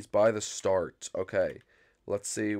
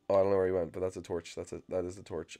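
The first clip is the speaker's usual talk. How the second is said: ordinary talk, nothing marked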